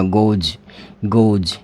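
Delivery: गोज ending in a voiced j. Shown as a demonstration. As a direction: close गोज on a buzzed "j", not s. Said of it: "यहाँ पर जे आएगा".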